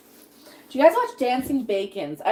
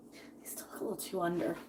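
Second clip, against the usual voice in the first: whisper; 4 semitones lower, 14 dB quieter than usual. Real, not acted. Cooking aside, whispered.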